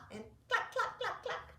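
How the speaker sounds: hen clucking. Shown as a demonstration. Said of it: "practice those sounds together".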